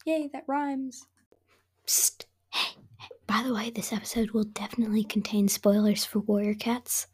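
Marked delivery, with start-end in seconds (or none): 1.9-7.1 hushed secret aside; whispering. Pretend.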